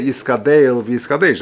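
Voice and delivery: "Yiskadel v'Yiskadesh" with "-deyl" and "-deysh", not "-dal"; tsere pronunciation. Shown as a demonstration. Rabbi teaching the congregation how to say Kaddish, carefully. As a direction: say "Yiskadel v'Yiskadesh" clearly, tsere on the last syllables. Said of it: "D'Yiskadel v'Yiskadesh B'Tzeirei".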